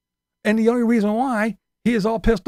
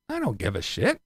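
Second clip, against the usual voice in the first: ordinary delivery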